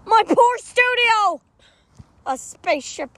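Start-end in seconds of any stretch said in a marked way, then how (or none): 0.1-1.4 wailing. Acted. Studio wrecked by spaceship.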